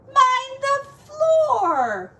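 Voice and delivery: high, slow, loud cry of Mind the floor; cried out. Acted. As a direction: wail it out at the muddy piglets. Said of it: "she said".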